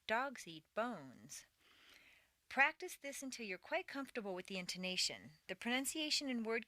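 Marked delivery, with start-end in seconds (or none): 0.1-1.5 slow, stretched "Dogs" and "bones", lighter "eat"; stressing only the nouns. Shown as a demonstration. Teacher models the exercise for learners, stressing only the nouns. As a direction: hit "Dogs" and "bones", draw them out. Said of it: "go through stressing only the nouns".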